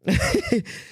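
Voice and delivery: high-pitched giggle; amused laugh at oneself. Real, not acted. Podcaster cracking up at their own bad accent, laughing.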